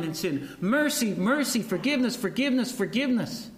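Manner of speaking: preaching at full force. Acted. Preacher urging the congregation on mercy, fervent and high. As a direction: build each word like a litany.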